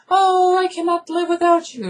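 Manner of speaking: exaggerated squeaky parody of a desperate lover; mock lovelorn girl. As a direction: wail it melodramatically in falsetto. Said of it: "she's like".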